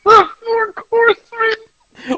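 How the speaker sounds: can't believe the joke he just heard; laughing disbelief. Real, not acted. Hearing the Four Coursemen idea, cracking up in disbelief.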